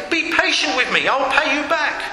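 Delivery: high-pitched plea; begging. A narrator's reading. Fellow servant to his creditor, begging. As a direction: beg for time, voice pitched high. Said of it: "begged him".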